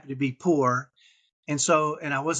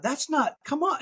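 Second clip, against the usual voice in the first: retold disbelief, voice jumping much higher; mock protest at her list of rules; incredulous protest; 11 semitones higher than usual. Retelling his reaction to rules.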